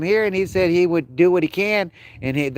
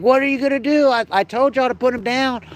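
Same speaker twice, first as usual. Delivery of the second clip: retold angry shout; 10 semitones higher than usual. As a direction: shout it like they're chasing you down. Retold fight with vet staff.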